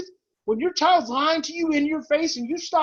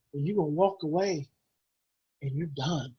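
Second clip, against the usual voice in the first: ordinary delivery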